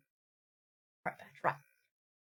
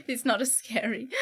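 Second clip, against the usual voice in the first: ordinary delivery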